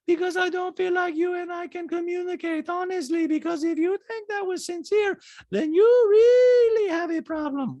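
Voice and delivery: high mimicry building to a loud wail; Diana. Diana doubting Sutton is sincere, high mock impression. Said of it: "Diana's like".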